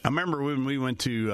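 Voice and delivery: ordinary talk, nothing marked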